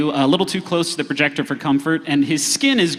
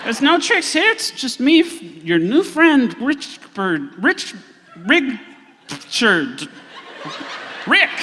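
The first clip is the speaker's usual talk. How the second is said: high, halting, faltering introduction; odd gray man; 7 semitones higher, slower than usual. Acted. Gray man calling himself their new friend, high and halting. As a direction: fumble for your own name in a high voice. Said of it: "looks at you with a blank expression".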